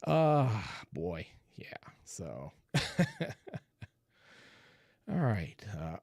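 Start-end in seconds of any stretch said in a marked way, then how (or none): 0.9-2.5 whispered aside. Real, not acted. Murmured aside while reading chat.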